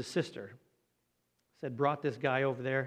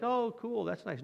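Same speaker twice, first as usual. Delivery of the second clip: high, airy sarcastic brush-off; mock breezy indifference; 8 semitones higher, slower than usual. Preacher mocking King Asa shrugging off the seer, sarcastic. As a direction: pretend the rebuke means nothing. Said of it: "No, he was upset".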